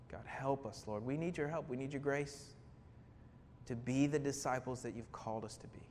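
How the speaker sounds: low, soft, earnest prayer voice. Real, not acted. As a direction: pray quietly, leaving pauses between phrases. Closes a prayer before preaching.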